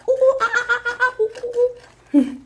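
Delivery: monkey calls. Retold or imitated. Monkeys climbing the tree.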